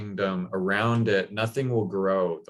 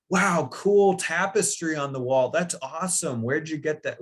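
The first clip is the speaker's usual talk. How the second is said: high, overexcited fawning gush; mock-rave about everything in the room; gushing over décor; 9 semitones higher than usual. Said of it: "making such a big deal out of everything".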